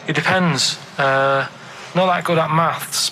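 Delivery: normal talking voice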